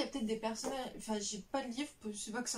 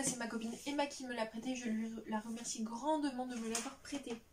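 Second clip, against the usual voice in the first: ordinary talk, nothing marked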